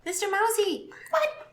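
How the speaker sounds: high sing-song call to a mouse; high cutesy call. Acted. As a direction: coax the rat in a high cute voice. Calling sweetly to a rat.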